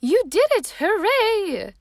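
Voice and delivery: put-on high, loud delighted cheer from an old aunt; joyful cheer. A narrator's reading. Great-great-aunt Rose cheers Rosie's first flop, loud and delighted. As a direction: whoop it out with pure joy.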